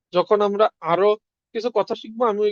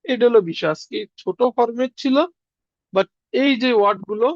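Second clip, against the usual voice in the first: normal talking voice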